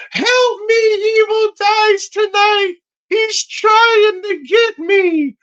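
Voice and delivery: very high, long screechy wail; hammed-up falsetto cry for help; mock scream. Nerd girl screaming to be rescued from sacrifice, high-pitched mock scream. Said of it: "she screams".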